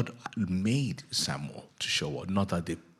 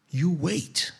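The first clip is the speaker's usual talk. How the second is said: normal talking voice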